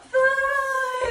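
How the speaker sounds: high, loud, long "fly"; excited launch cue. Pretend. Teacher launching the eagle on three, high excited call. Eagle takes off after count.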